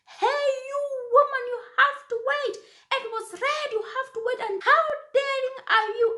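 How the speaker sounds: high, loud shout at a jaywalker; retold shout. Old woman at her window shouting high and loud at a jaywalker. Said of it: "she shouted at me".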